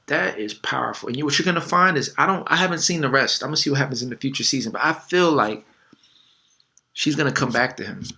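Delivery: ordinary talk, nothing marked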